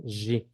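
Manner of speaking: G said as a buzzing "zhi"; voiced zh pronunciation. Shown as a demonstration. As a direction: say G like French "j" plus ee. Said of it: "siempre se pronuncia".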